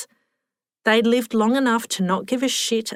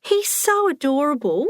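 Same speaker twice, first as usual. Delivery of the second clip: put-on high-pitched, doting elderly woman; gushing old lady; 7 semitones higher than usual. A narrator's reading. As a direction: coo over the boy in a high voice. Old Helen gushes about child.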